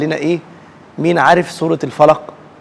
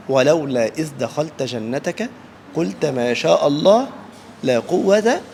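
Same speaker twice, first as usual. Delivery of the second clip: measured Quran recitation; 2 semitones lower, 5 dB quieter, slower than usual. Acted. Teacher closes with verse.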